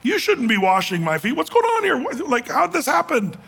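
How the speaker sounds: high, startled disbelief; shocked protest. Retold or imitated. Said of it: "the disciple who goes".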